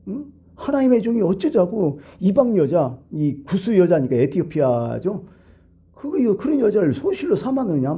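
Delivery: high, indignant accusing voice; mock scolding siblings. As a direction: scold like a gossiping older sister. Miriam and Aaron slander Moses. (미리암과 아론이 모세를 비방하듯, 높고 따지는 목소리)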